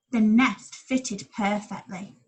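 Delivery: ordinary delivery, nothing marked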